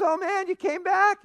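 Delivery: high, astonished exclamation; startled disbelief. Acted. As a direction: gasp it out at a ghost. Golfer startled by ghost friend.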